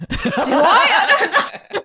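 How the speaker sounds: nervous laughing answer. Retold or imitated. Actress tells director she might not know lines, laughing nervously. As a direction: laugh through the panic.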